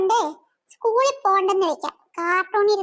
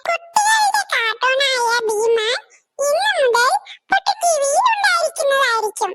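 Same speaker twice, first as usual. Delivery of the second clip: higher, measured announcer voice; radio news read; 5 semitones higher than usual. Acted. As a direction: read the cartoon news like a bulletin.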